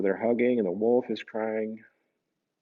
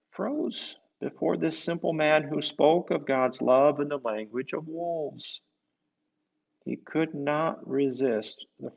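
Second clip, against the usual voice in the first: story narration; 3 semitones higher, 4 dB louder than usual. Acted. Reading aloud to children, lively storyteller. Reads picture book aloud.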